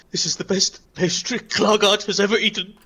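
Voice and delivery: rapturous Klargard. Acted. Klargard praises the best pastry ever, anguished rapture. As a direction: build grandly toward heartbreak. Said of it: "He, he says".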